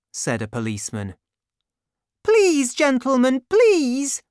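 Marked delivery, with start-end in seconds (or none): 2.2-4.2 high loud begging cry; panicked frantic pleading. A narrator's reading. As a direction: beg them desperately to stop. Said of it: "cried the woman".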